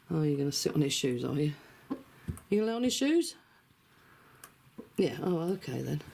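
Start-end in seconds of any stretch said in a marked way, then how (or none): 2.5-3.3 higher teasing question to the hen; pet talk voice. Real, not acted. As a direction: tease the bird, pitched up. Talking to pet chicken.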